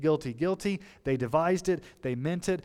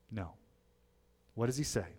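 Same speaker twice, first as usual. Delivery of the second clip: ordinary talk, nothing marked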